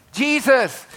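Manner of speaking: retold bedtime yell. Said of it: "I'd say".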